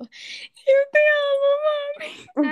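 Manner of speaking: loud, high-pitched mock wail; llorando exagerado. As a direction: imitate yourself bawling loudly, pitch way up. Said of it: "Pero llorando". (imitando un llanto a gritos, muy agudo)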